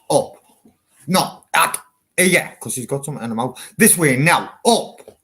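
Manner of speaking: loud, high commands to the dog; dog owner ordering dog upstairs, loud; commanding the dog. Real, not acted.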